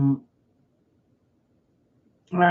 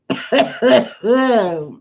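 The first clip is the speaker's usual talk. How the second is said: loud hacking cough; hard cough; 4 semitones higher, 5 dB louder than usual. Real, not acted. Coughing fit on stream.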